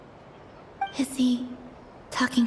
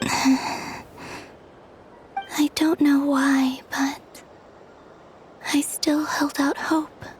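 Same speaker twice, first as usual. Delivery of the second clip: hushed, whispery admission; wistful confession. Acted. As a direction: say it mostly on the breath, halting. Lone speaker admits a lingering hope.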